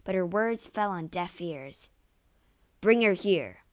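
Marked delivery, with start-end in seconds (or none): 2.8-3.7 loud, forceful command; commanding order. A narrator's reading. Othello orders Desdemona brought in, loud command. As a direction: order it loudly, no argument allowed. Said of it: "Othello ordered".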